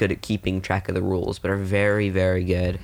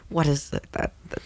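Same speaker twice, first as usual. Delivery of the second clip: snooty aristocrat; 6 semitones higher, 3 dB quieter than usual. Pretend. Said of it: "they're gonna be like".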